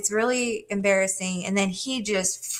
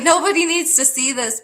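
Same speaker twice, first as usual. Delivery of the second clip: mock exclaim; 7 semitones higher, 5 dB louder than usual. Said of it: "I was like".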